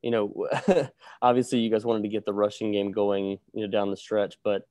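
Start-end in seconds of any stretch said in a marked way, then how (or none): none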